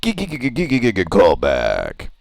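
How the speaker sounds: stuttered robotic skipping sound; glitching phone. Pretend. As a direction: skip like a broken recording.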